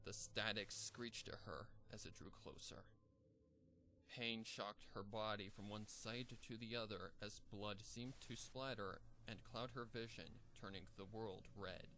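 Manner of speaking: ordinary delivery, nothing marked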